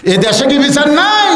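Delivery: very high, shouted indignant question; acted high-pitched protest; outraged shout. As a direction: shout it as an outraged wife demanding justice. Wife's outburst, repeated louder.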